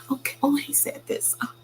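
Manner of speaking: very high, meek squeaky voice; squeaky mock submission; mock timid voice. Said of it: "I'm not gonna sit down".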